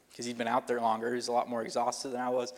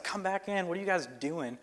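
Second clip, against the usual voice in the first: mock lifeguard shouting from shore, pitched up high; retold lifeguard yell; 6 semitones higher, 3 dB quieter than usual. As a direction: call them back in like a lifeguard, half-yelled. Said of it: "he's yelling at us".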